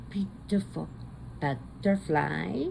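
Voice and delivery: put-on slow, sing-song little girl; slow toddler voice; lightly voiced toddler. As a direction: imitate a toddler saying it carefully.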